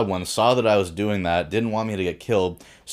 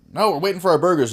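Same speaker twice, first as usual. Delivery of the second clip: quick defiant reply; 9 semitones higher, 4 dB louder, faster than usual. Retold or imitated. Speaker answering the Viking dude.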